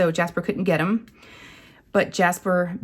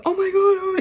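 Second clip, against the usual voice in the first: panicked cry; 13 semitones higher than usual. Retold or imitated. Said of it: "He's like".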